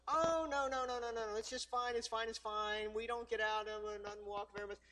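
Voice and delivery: mock defensive dog owner, words tumbling out; dismissive friend. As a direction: brush it off in a run of denials. Friend brushing off the warning.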